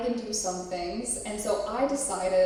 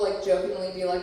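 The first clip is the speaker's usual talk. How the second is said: ordinary delivery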